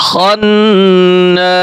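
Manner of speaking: খন্না with the nn held through the nose; nasal hold. Shown as a demonstration. Teacher demonstrating ghunna on খন্না. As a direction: hum the double n in খন্না. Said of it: "গুণনা করতে হবে".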